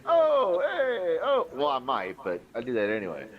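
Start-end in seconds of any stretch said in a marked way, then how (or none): 0.0-1.5 high, loud mock New Yorker exclamations; shouty mock New York accent. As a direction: ham up a New York guy. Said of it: "go like".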